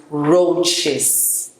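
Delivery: slow, drawn out "roaches" as ROH-chiz; careful pronunciation. Shown as a demonstration. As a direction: stretch "roaches" out for learners. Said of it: "it's pronounced".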